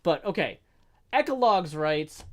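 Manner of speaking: ordinary talk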